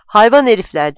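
loud, angry outburst in narrated dialogue; indignant cursing. A narrator's reading. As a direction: curse them with force. Furious after being mocked on phone. (öfkeli, yüksek sesle söverek)

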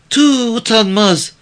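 put-on high, slow girl's rebuke; high young voice full of scorn; scornful village girl. A narrator's reading. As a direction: shame him in a high, drawn-out girl's voice. Girl spits scorn at man.

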